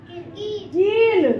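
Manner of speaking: कील stretched very slowly, sound by sound; drawn-out word. Shown as a demonstration. Teacher blending की and ल into कील, very slow. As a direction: draw out कील for the children. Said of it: "क्या बन गया?".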